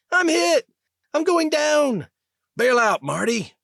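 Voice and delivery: panicked scream. Acted. Pilot shot down, screaming over the comm in panic. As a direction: scream as the ship spirals down.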